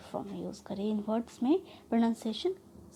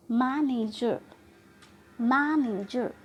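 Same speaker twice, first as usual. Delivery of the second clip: "Manager" with मै stretched, the rest relaxed; stress on मै; much slower than usual. Shown as a demonstration. Teacher showing stressed versus relaxed syllables, मै drawn out in "manager". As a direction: stretch मै, say नेजर easily in "manager". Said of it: "जब हम मैं बोल रही हूँ तो उस वक्त मैं ट्रस्ट कर रही हूँ".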